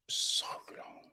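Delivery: a hushed, weary "so long"; whispered aside. Shown as a demonstration. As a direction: whisper "so long" softly, almost breathless. Repeats "so long" for effect.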